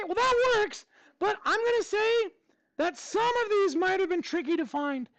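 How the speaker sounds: high, squeaky cartoon mouse voice held through the whole lesson; Mickey Mouse voice. Teacher quizzing his class on equations in a high Mickey Mouse voice. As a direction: teach the math steps as a cheerful cartoon mouse. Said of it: "I gotta do the voice too".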